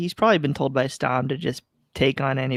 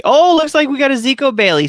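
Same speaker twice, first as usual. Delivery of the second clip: excited; 12 semitones higher, 8 dB louder than usual. Real, not acted. Spots a new player coming on.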